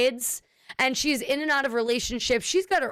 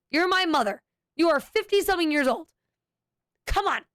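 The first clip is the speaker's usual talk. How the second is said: normal talking voice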